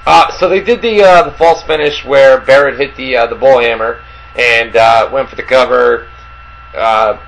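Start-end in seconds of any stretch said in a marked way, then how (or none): none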